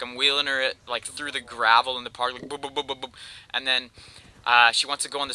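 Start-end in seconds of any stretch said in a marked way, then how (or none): 2.5-3.1 soft bumping noises. Retold or imitated. Bumping wheelchair over the gravel.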